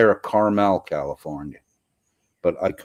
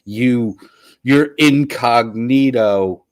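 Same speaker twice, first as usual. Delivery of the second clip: mock indignant reader; 5 semitones higher, 6 dB louder, slower than usual. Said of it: "Paul says".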